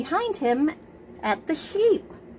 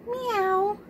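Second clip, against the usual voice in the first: high mewing meow; cat meow; 11 semitones higher than usual. A narrator's reading. Cat answers Duck with a high meow. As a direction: mew it like a cat. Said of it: "said Cat".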